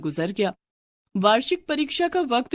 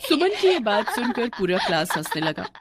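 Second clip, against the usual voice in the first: ordinary delivery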